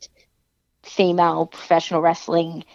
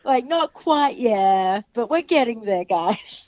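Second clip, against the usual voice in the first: higher, laughing through a sheepish answer; laughing reply; 6 semitones higher, much slower than usual. Retold or imitated. Answering fans about Australian wrestling, high and laughing. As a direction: giggle while half agreeing. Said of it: "And I'm like".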